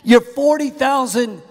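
mock-scold with pitch lifted, leaning on the big numbers; incredulous rebuke. Preacher thinking at the complaining airplane passenger, incredulous.

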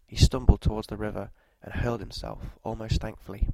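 ordinary delivery, nothing marked

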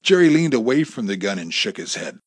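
ordinary delivery, nothing marked

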